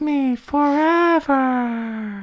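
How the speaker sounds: slow, high creepy doll voice; eerie calm doll voice; too calm creepy doll. Acted. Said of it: "It sounds creepy".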